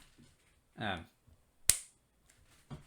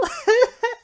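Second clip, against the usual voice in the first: squeaky laugh fit; real high-pitched laughing; cracking up; high burst of laughter; 11 dB louder than usual. Real, not acted.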